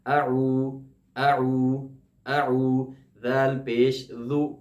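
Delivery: اعو with ain kept distinct from hamza; slow, separate hamza and ain in اعو; careful ain. Shown as a demonstration. Teacher drilling the distinction for students. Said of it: "حمزہ کی جگہ عین نہیں".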